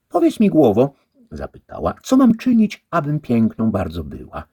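wysoko i głośno, kobiecy głos; dama pyta. A narrator's reading. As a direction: ciekawska dama dopytuje. A lady asks the head. (przyjaciółka żony pyta głowę)

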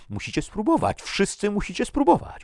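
very high, loud, mounting insistence; frenzied urging. A narrator's reading. Crazed man urges everyone to taste.

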